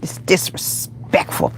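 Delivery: "disrespectful" whispered slowly, syllables drawn apart; slow, voiceless "disrespectful"; emphatic whisper. Real, not acted. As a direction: hush and stretch "disrespectful" for emphasis. Repeats the word for emphasis.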